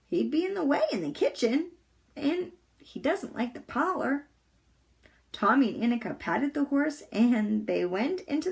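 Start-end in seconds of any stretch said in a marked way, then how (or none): 0.1-4.3 thoughtful Pippi. A narrator's reading. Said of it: "said Pippi thoughtfully".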